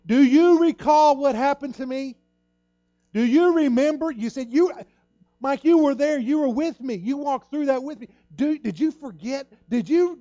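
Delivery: high, loud, pressing questions; high and loud, urging him to remember; a friend pleading loudly; urgent reminder. Retold or imitated. Pastor reminding of his ordeal.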